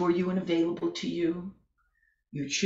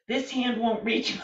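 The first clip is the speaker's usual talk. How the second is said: ordinary delivery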